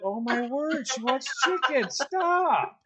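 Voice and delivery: high, fluttery pearl-clutching; mock scandalized guest. Guest gossips about the bride's menu, mock scandalized. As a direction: gasp it out in a high mock-horror.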